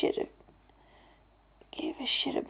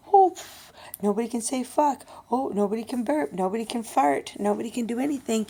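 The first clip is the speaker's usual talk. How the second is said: mocking imitation; 5 dB louder than usual. Fifty-year-old griping about day program rules, mocking. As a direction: scoff out each rule in mockery.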